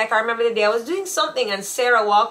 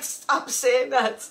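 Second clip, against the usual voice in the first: ordinary talk, nothing marked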